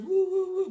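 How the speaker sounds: loud, high-pitched siren wail; imitated siren. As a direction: mimic police sirens closing in behind. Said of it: "There's all sirens".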